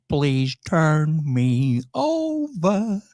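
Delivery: slow, stretched imitation of a vocoder voice; robot voice. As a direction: drag it out like a robotic computer. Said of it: "In that computer voice".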